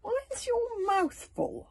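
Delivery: high, slow and stern; slow suspicious question. A narrator's reading. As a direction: make him own up. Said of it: "asked Hiccup sternly".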